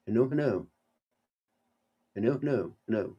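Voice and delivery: faint broken line; call dropping out. Real, not acted. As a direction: voice fading on a bad call. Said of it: "I can't hear you".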